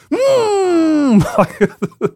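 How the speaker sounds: long, high-pitched "mmm" kiss noise; imitated kiss hum. Podcaster imitating the awkward movie kiss, long high hum. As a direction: hold an exaggerated high hum, then crack up. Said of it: "it was just like".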